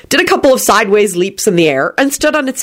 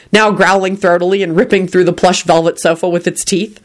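usual speaking voice

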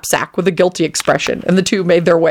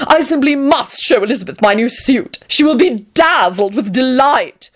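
Tony, affected and gushing; 7 semitones higher than usual. A narrator's reading. Tony delighted.

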